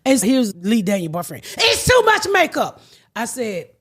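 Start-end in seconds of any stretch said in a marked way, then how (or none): 1.6-2.8 high, fussy mock complaint. Mocking the boyfriend's complaint in a high fussy voice. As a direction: mimic his fuss about the makeup.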